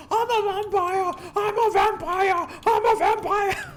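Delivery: high, loud panicked screaming, each repeat stretched; mock vampire panic scream. Imitating Cage screaming in the street, hammed-up high yell. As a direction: wail it like a yuppie running down the street. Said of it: "the street screaming".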